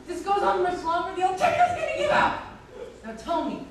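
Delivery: ordinary talk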